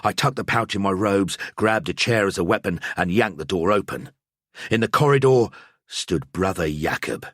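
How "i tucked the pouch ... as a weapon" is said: plain reading voice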